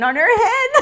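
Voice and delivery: real amusement bursting through the words; laughing. Real, not acted. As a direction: crack up on the punchline. Cracks up at own punchline.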